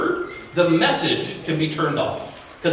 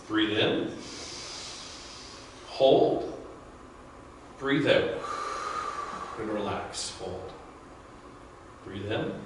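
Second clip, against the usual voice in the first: soft, unhurried breathing guide, fading softer; guided breathing; 4 semitones lower, 10 dB quieter than usual. Acted. Coach leading the group through square breathing, soft and calm. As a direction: lead the room through each breath, calm and hushed.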